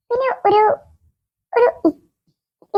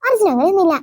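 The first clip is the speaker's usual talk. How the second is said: ordinary talk, nothing marked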